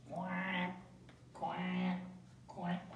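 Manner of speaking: imitating a duck, quack by quack; light mock quacking. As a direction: play the duck for the clue.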